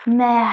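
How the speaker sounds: French mer said as nasal mɛ; French pronunciation. Shown as a demonstration. Teacher shows students how French mer sounds. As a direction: say मैं like the French word mer. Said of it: "इसका हमारा sound होता है".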